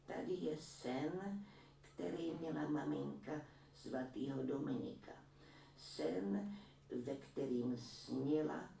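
a granny boasting about her son's painting; proud old woman. A narrator's reading. Old woman voiced in story. (vyšší pomalý hlas pyšné stařenky)

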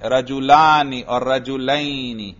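slow "رجلانی" with long aa, "رجلین" ending in -ayni; contrasted dual endings. Shown as a demonstration. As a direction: draw out both endings, "رجلانی" against "رجلین". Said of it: "نصبی جری میں کیسے پڑھتے ہیں". ("رجلانی" الف سے، "رجلین" یا سے، ٹھہر کر)

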